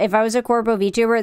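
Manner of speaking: ordinary talk, nothing marked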